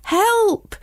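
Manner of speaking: high, drawn-out cry for help; storybook pupil squealing in alarm; acted shriek. A narrator's reading. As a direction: shriek it without full volume. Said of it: "shrieked Samantha".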